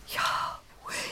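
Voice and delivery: slow reverent whisper; hushed like a breath itself. Shown as a demonstration. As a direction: breathe the words out slowly, barely voiced. Hushed reverent name meaning.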